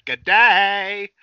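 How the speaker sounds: long, drawn-out Australian greeting, high and loud; mock Australian accent. Podcast host answers co-host's jibe about sounding Australian, mock Australian accent. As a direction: stretch it out in a hammed-up Aussie accent.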